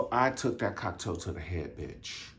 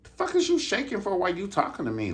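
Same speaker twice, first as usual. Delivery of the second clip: high indignant retort; 11 semitones higher than usual. Retold or imitated. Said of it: "I was like".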